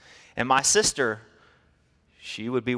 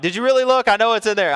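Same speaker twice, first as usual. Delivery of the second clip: much higher, louder doubting; wife disbelieving; 9 semitones higher, 7 dB louder, faster than usual. Retold or imitated. Wife to husband about the purse, loud and doubting. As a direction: challenge him sharply.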